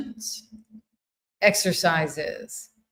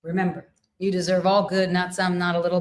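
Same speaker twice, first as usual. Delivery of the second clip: usual speaking voice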